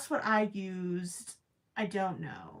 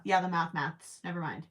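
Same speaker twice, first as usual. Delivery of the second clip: ordinary talk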